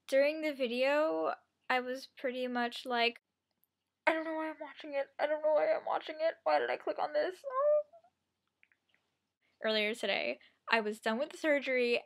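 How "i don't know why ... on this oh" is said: fast, high, panicky self-talk; mock panicked muttering. Retold own panic watching video.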